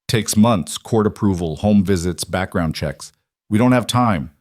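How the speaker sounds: usual speaking voice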